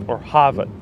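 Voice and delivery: Harvard in a Boston accent, r dropped; a playful taste of Boston accent. Shown as a demonstration. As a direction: say it like a Bostonian, "Hahvahd". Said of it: "I won't do too much with the Boston accent".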